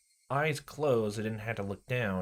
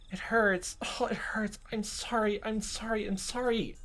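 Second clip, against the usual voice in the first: high breathy whimpered apology; whimpering Rena; 12 semitones higher than usual. Acted. Rena apologizing in pain, high whimper.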